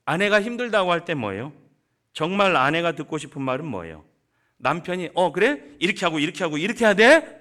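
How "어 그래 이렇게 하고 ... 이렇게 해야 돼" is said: louder, higher, pushy husband listing fixes; mock lecturing husband. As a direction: rattle off solutions like a know-it-all husband. 남편이 해결책 늘어놓는 흉내. (해결책만 늘어놓는 남편 흉내, 크고 높게)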